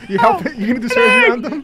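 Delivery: high, loud, laughing banter; giddy shouting. Real, not acted. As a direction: yell it, cracking up. Laughing, mocking the medic idea.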